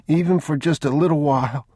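put-on a small boy feeling ill; sick boy.

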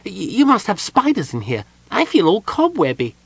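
high kender voice, uneasy and squirmy; a nervous little kender guessing at the curse; high, uneasy kender worrying aloud; kender Tasselhoff. A narrator's reading.

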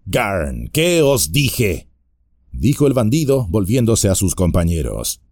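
bar their way with a sneer; burly bandit voice, taunting; menacing bandit. Acted. Bandit scoffs to his mates.

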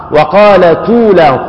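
وقالت أولاهم read slowly as وقالتُ ولاهم; naql reading. Shown as a demonstration. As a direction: move the damma onto the ta of وقالت and drop the hamza. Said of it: "نضعها على التاء وتحرك بنفس الحركة".

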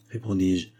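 ordinary delivery, nothing marked

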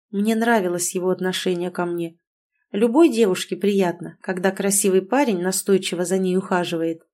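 ordinary delivery, nothing marked

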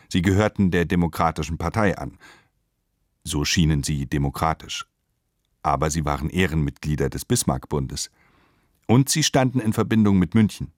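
normal talking voice

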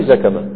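زكمة said with a-vowels, "zakama"; rejected active form. Shown as a demonstration. Teacher telling students what not to say, active pronunciation. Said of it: "ما في زكمة".

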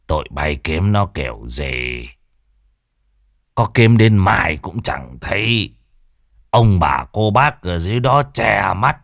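lightly voiced gruff village elder; a hint of a rasping old man giving orders; elder scolding the villagers, lightly hoarse. A narrator's reading. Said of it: "giọng nói khàn đục".

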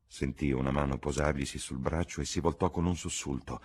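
usual speaking voice

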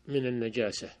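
normal talking voice

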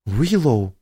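reproachful exclamation, falling. Acted. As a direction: scold her by name. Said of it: "укоризненно воскликнула Тина".

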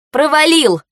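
high, forceful bark. A narrator's reading. Heroine exposing the driver's failed exam, barking. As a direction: bark the verdict out. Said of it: "рявкнула я".

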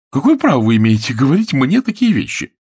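high, loud indignant outburst; flaring up. A narrator's reading. Woman snaps at insulting fiancé, flaring up. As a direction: lose your temper on the question. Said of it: "вспылила она".

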